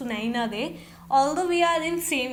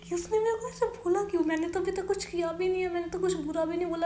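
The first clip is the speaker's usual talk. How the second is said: upset, near tears; 6 semitones higher, faster than usual. Retold or imitated. Retelling tears by water cooler.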